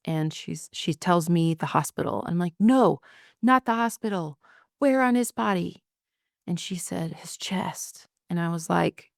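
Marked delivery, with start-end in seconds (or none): 2.6-5.8 higher, urgent, pressing for the answer; desperate wife demanding where he was hit; frantic question. Retold or imitated. Frantic wife asks where shot. 7.1-8.1 breathless hushed whisper of dreadful news. Retold or imitated. Mother-in-law breaks the terrible news.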